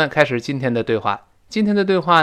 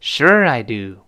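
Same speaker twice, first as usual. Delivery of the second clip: slow model with "Sure" strong; stress on SURE; 2 semitones lower, much slower than usual. Shown as a demonstration. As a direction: punch "Sure" and draw out "I do". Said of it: "时而中毒".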